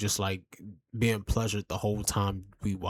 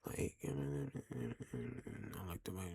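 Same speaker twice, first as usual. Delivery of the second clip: whisper; 6 semitones lower, 15 dB quieter, much slower than usual. Pretend. Mocking her soft talk.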